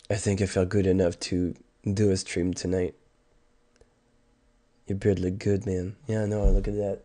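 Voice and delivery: ordinary talk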